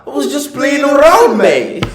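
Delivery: high, loud playful Aussie drawl; mock Aussie bloke. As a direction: play the cheeky Apex bloke. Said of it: "That was exactly how he talks".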